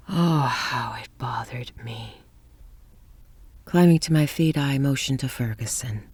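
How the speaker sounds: ordinary delivery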